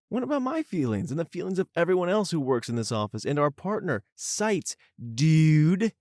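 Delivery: high-pitched, exasperated protest; indignant complaint. Real, not acted. Griping about the boss sparing Pat.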